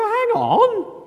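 high-pitched, incredulous interruption; indignant objection. Acted. Creditor hears his debt being cancelled, indignant. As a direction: cut in, affronted.

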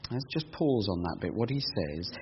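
ordinary talk, nothing marked

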